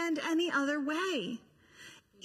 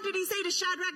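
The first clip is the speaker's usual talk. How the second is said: ordinary delivery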